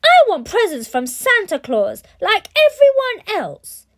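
put-on high, loud furious child's roar; a spoiled boy bellowing at his parents; roaring brat. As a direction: tantrum yell. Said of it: "roared Bradley".

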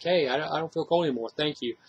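a hushed, grateful thank-you; grateful, relieved. Retold or imitated. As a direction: say it like a gift, gently. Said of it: "it's like it's a good thing".

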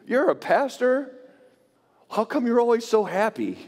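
lightly voiced gym buddy in disbelief; surprised gym guy. Gym acquaintance learning he's a pastor, surprised. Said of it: "And he said to me".

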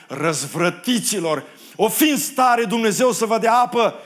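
loud, high angry roar; furious shout. Acted. Moses before two million people at the rock, furious shout. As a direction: bellow it at a rebellious crowd. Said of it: "Și Moise se prinde mânie".

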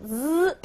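a drawn-out buzzing "z"; voiced z sound. Shown as a demonstration. Teacher showing the liaison sound of s, sustained "z". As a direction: hold "z" as a lone buzzing consonant. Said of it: "它的发音呢要发成z这个音".

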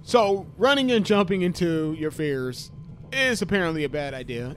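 usual speaking voice

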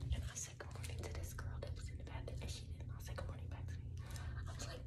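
fast, hushed whispered gossip. Real, not acted. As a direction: whisper it quickly so nobody hears. Telling story quietly in bathroom.

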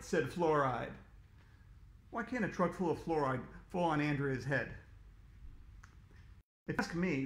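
usual speaking voice

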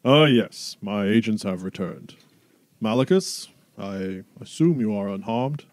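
big, loud, commanding noble; booming Duke voice. Acted. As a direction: boom it out like a proud duke. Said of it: "the Duke speaks with his big booming voice".

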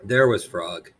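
usual speaking voice

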